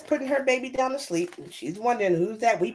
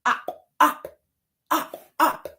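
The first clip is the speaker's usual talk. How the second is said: short, high frog noises; high storybook frog croaks; frog call; 6 semitones higher, much slower than usual. Acted. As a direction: hop out the syllables like a frog.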